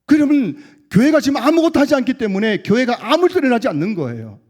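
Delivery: loud, high pulpit exhortation; full-force preaching. Real, not acted. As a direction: cry it out to rouse the congregation.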